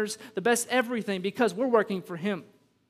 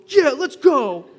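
put-on pumped-up kid; 6 semitones higher, 13 dB louder, much slower than usual. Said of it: "I saw one kid go".

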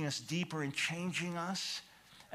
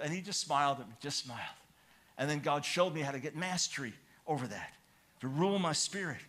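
ordinary talk, nothing marked

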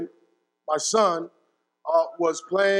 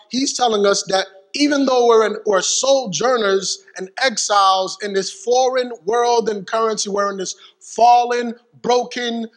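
louder preacher's build through the list; fervent preaching; 3 semitones higher, 5 dB louder than usual. Acted. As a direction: drive it home to the congregation. Preaching rises to full force.

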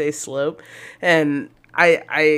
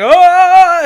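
higher, louder mock cry; mock shout; 12 dB louder than usual. Second singer shouts on emerging. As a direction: yelp it out for the joke.

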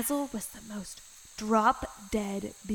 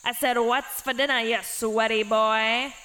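hammed-up goblin repeating louder; louder goblin demand; 8 dB louder than usual. A narrator's reading. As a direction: bellow it the second time, impatient.

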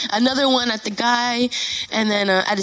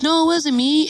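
higher-pitched protest of innocence; retold defensive denial; mock innocent denial; 6 semitones higher, 4 dB louder than usual. Acting the accused denying it.

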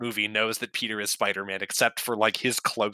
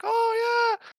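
shocked "oh yeah". Retold or imitated. Peter reacts to the engagement news.

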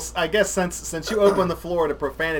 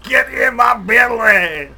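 loud, higher, slow mock Scottish shout; Fat Bastard line; 6 semitones higher, 12 dB louder, slower than usual. Quoting Fat Bastard on the show, slow loud mock Scottish shout. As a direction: bellow it like a greedy Scotsman. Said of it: "fat bastard quote".

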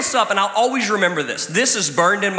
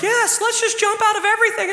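mock reckless teenager. Teenagers, you're like.

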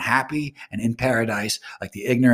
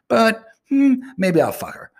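sly musing; 11 semitones higher, 4 dB louder than usual. Retold or imitated. Retold thought, sly turn.

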